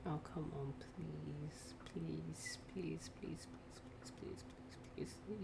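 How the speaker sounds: whispered desperate pleading; whispered begging. Real, not acted. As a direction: beg under the breath. Whispering to the car.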